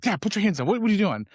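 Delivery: mock alarm, hurried reprimand of a parent; imitated freak-out. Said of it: "They freak out".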